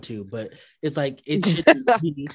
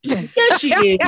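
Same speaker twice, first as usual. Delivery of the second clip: mock insistence; 8 semitones higher, 4 dB louder than usual. Mock insistent, high and loud.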